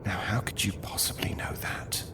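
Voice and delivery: hushed, breathless whisper; a disbelieving whisper to the boy. Acted. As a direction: whisper it so the guard can't hear. Martin questions the prisoner tensely.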